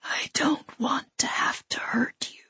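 eerie hushed threat, fully whispered; ethereal, terrifying whisper. A narrator's reading. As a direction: whisper the threat with ghostly softness. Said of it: "whisper that was, all at once, both ethereal and terrifying".

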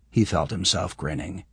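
usual speaking voice

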